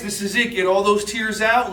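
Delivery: ordinary talk, nothing marked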